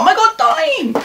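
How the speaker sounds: high, slow drawn-out squeal; excited squeal. Real, not acted. Reading a sweet card from Chloe Morello, slow high squeal. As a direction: melt over it, way up high.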